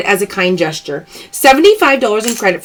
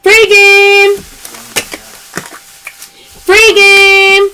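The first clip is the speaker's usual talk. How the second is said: high, loud cheer of free game; loud happy announcement; excited callout; 8 semitones higher, 5 dB louder, much slower than usual. Real, not acted. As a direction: sing it out to the viewers. Live sale callout.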